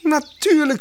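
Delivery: cheerful bird voice. Acted. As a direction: call out proudly like a clever bird. Mockingbird voice. (vrolijke spotvogelstem, hoger)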